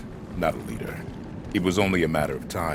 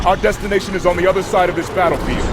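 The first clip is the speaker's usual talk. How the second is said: higher and louder orders over gunfire; raised voice in combat; battle shout; 13 semitones higher, 8 dB louder than usual. Acted. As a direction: shout commands across the battlefield. Giving orders mid-battle.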